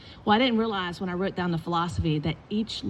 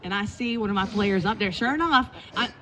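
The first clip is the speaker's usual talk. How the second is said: usual speaking voice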